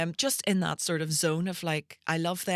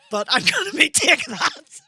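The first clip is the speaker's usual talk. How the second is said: louder, higher, slow and laughing; 10 semitones higher, 10 dB louder, slower than usual. Retold or imitated. Recalling her teenage delusion, loud laughing. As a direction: crack up at your own delusion.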